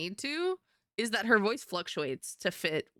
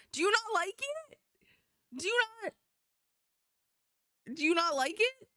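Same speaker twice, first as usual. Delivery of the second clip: high sweet teasing voice; 11 semitones higher, faster than usual. Acted. Teasing chat with a high tickly voice. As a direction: tease them in a tiny sweet voice. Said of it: "Stop tickling the brain".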